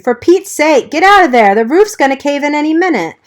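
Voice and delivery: high, loud frantic scream. A narrator's reading. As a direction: scream a warning up at the window. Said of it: "he screamed".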